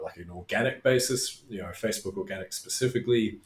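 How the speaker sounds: ordinary talk, nothing marked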